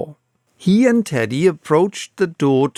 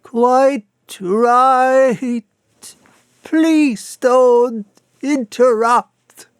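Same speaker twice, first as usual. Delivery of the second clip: high, slow, jerky words; jerking intonation; 10 semitones higher, 4 dB louder, much slower than usual. A narrator's reading. The vicar answers through the door with jerking intonation. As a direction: bark it out in odd jerks. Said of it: "curious jerking intonation".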